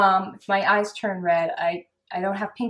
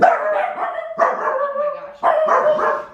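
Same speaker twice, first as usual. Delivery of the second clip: exasperated; 7 dB louder, much slower than usual. Real, not acted. Bloopers, someone ruins take.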